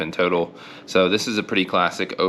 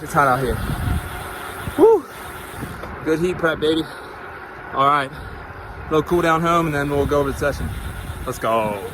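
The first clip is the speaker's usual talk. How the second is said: winded, raised post-effort voice; catching breath after the last rep; cycling hard; 8 semitones higher than usual. Real, not acted. Just finished hard intervals.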